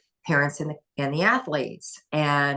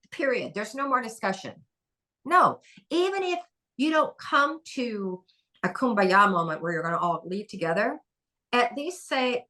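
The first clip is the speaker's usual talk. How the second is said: usual speaking voice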